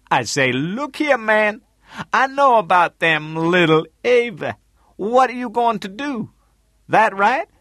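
high, loud character voice; Tootie reporting back. A narrator's reading. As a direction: report the call loudly, pitched well up.

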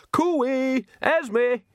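high sing-song call across a yard. A narrator's reading. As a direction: hail a friend from afar. Calling out across courtyard.

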